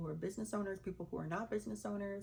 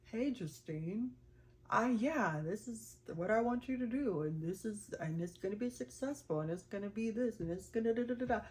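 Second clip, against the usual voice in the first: mock voice of God; 3 semitones higher, faster than usual. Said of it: "God isn't always gonna answer it like".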